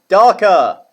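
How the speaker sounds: loud, high, falling teacher's call; model for repetition. Shown as a demonstration. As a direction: call it out big for pupils to echo. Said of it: "Can you say darker?".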